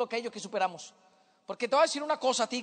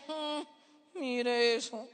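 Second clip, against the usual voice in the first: softer mock disapproving remark; judgmental onlooker; 3 semitones lower, 6 dB quieter, much slower than usual. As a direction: lightly play a passer-by passing judgement. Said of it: "la gente se para ahí y dice".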